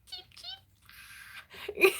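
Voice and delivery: high chirping cheep cheep; chick cheep. Pretend. Imitating chick.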